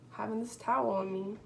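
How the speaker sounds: ordinary talk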